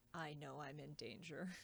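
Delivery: mock dread, nearly under the breath; ominous hush. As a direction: drop to a slow hush on the danger line. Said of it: "Rex Verity says".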